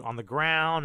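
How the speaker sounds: ordinary talk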